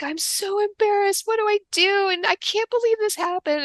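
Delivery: playing the embarrassed mom in tears; imitating crying mom. Retelling the other mom's tearful apology, high crying voice. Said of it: "she was crying".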